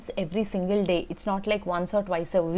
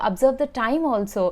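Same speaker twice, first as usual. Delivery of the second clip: normal talking voice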